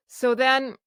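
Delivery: ordinary talk, nothing marked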